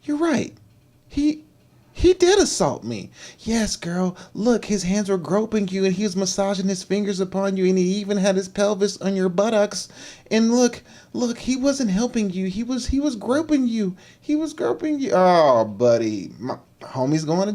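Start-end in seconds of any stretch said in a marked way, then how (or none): 0.0-3.1 high-pitched mock realization; high, hesitant put-on female voice; imitating the woman. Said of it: "And she's like". 3.4-15.1 high-pitched mock girlfriend voice; high, insistent put-on friend voice; imitating egging-on friend. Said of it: "Her friend's gonna get in her head like".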